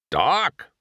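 high-pitched disbelief; astonished, rising exclamation; incredulous cry. Acted. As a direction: cry it out as a baffled question. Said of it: "Sheriff cried".

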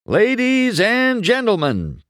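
high, loud stadium announcer; big race announcer call. Acted. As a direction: boom it over the P A. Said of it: "Bob announced".

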